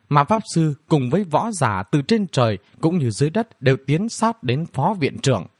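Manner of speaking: usual speaking voice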